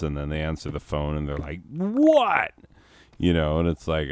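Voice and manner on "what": high, loud, put-upon squawk; mock an irritated receptionist with a high, loud yelp; bent out of shape. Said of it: "always seems bent out of shape".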